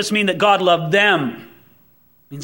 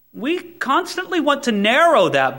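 ordinary delivery

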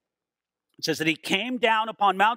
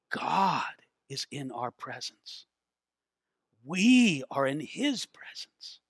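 slow, softened and lower, struck with awe; hushed awe; 5 semitones lower, 5 dB quieter, slower than usual. Acted. Preacher acting people before God at the mountain, in hushed awe. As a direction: breathe it slowly in stunned reverence. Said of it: "We'd be going".

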